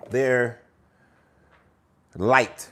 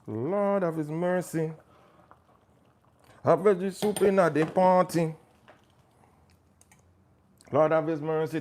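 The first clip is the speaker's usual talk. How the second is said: put-on Jamaican accent; 5 semitones higher than usual. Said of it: "This part is not Jamaican".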